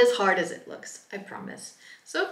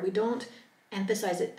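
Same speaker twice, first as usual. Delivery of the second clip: ordinary talk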